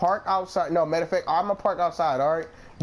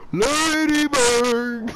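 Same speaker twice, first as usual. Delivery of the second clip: slow, stretched, louder call; slow Hank Hill calling his dog; Hank Hill calling Lady Bird; 4 semitones higher, 5 dB louder, much slower than usual. Shown as a demonstration. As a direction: draw out Lady Bird like a proud Texan owner. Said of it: "I know how to do it".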